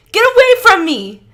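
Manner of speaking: piercing high scream. Acted. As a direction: scream at him to go. Tyler keeps screaming.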